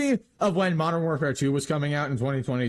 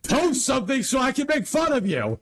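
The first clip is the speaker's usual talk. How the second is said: high-pitched yelled demand; mock-angry shout; 11 semitones higher than usual. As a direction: holler it at an absent video maker. Calls out an absent video maker.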